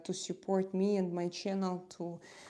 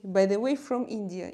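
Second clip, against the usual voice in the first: ordinary talk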